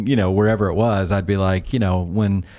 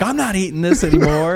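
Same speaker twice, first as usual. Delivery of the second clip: disgusted eater; 9 semitones higher, 3 dB louder than usual. Retold or imitated. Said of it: "the people that were eating 'em are like".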